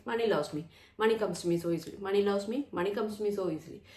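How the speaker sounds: rapid rote repetition, run together; robotic affirmation. Shown as a demonstration. Coach demonstrating affirming money as just words, fast and robotic. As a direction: reel it off fast, as mere words. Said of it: "It's just words".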